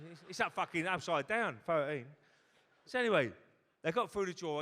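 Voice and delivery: ordinary talk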